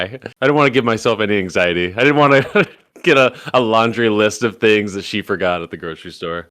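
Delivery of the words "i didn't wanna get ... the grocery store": usual speaking voice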